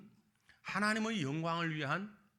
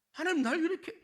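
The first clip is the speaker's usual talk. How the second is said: high, louder mock complaint; complaining to God; 14 semitones higher, 6 dB louder, faster than usual. Preacher acting out grumbling prayers, whiny. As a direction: push the grumble up high, then settle. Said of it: "이렇게 원망할 때가 얼마나 많아요".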